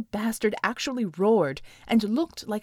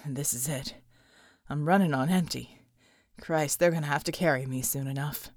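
low, tired soldier's voice; grim and worn down; weary character voice; 8 semitones lower than usual. Acted. John muttering to his team, low and exhausted. John's voice.

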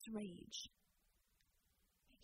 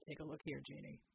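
a lightly lowered, soft gruff soldier; Bulwark's low voice; 7 semitones lower, 6 dB quieter than usual. Acted. Bulwark calling Jeannie over to the armor, low and soft. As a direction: quietly point something out, voiced low.

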